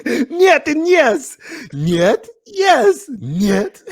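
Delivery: high, slow, loud Russian "Нет and yes"; Russian accent. Pretend. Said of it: "like a Russian dance D J".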